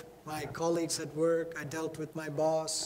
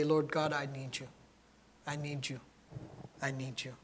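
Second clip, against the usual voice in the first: hushed prayer; 3 semitones lower, 8 dB quieter than usual. Acted. A whispered plea to God.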